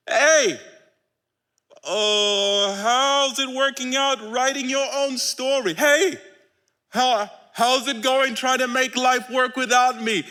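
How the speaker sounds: playful needling call; teasing Jesus. Acted. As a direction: rib them like an old friend. Preacher voicing Jesus teasing.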